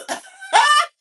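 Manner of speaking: a loud, high shriek of laughter; real cackling scream of laughter. Real, not acted. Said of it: "I'm screaming".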